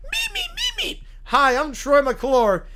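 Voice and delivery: very high squeaky meeping; Beaker voice. Acted. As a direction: squeak it out like a nervous Muppet. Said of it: "Muppet Beaker".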